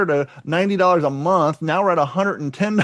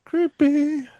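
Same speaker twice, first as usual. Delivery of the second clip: high creepy voice; 9 semitones higher, much slower than usual. Acted. Spam call joke, high voice.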